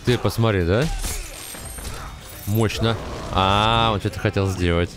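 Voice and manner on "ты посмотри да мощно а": ordinary delivery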